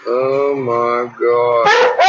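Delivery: very slow, drawn-out savoring; tasting the butter, slow and drawn out. Real, not acted. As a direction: stretch it out like it's the best bite ever. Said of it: "too delicious".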